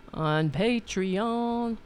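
ordinary delivery

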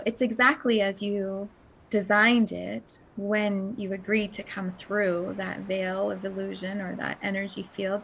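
ordinary delivery

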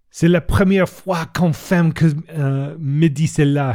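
slow, high French exclamation in rusty French; mock-indignant outburst in rusty French; exclaimed in French. A narrator's reading. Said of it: "he exclaimed".

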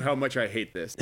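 ordinary delivery

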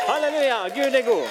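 high, loud crowd cheer; jubilant praise. Acted. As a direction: lead a crowd in exultant praise. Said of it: "begynte å juble og prise Gud".

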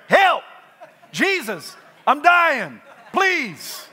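loud, high, frantic pleading; desperate cry for help. Pretend. Preacher acting out a sick man's loud frantic prayer. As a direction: cry out like a dying man begging Jesus. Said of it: "Sickness will automatically change your prayer life".

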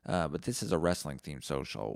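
normal talking voice

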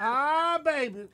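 hammed-up high cry, easing off; mock outburst. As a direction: playfully exclaim it high.

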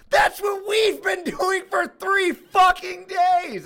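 retold fed-up shout; exasperated outburst. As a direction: blurt it out in disbelief. Retold outburst at carpet guy.

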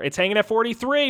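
ordinary talk

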